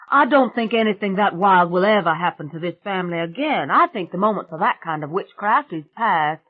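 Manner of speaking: put-on loud, high, brash country girl; a loud, high swamp witch bragging to the family. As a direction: belt it out like a cocky backwoods girl. [name]'s rowdy swamp girl voice.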